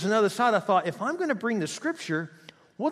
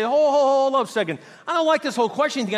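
loud, high, abrupt interruption; God objecting; 9 semitones higher, 7 dB louder than usual. Acted. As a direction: stop the questioner in their tracks. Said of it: "another side of this to say".

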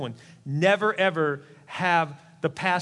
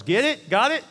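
higher and louder, demanding an answer; emphatic loud check-in; 7 semitones higher, 6 dB louder than usual. Real, not acted. Preacher demanding the congregation answer, loud and high. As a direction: press the crowd for a loud yes. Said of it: "Don't make me say it again".

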